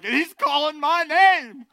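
high, loud, slow astonished voice; a dead man startled to hear his name; hammed-up startled ghost; surprised dead man. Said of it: "Think of the Bob and Jim's like".

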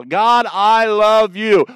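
slow, loud, each word weighted; earnest, drawn-out "God, I love you"; simple heartfelt prayer. Shown as a demonstration. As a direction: say it plainly and slowly, one syllable at a time. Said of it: "just one syllable".

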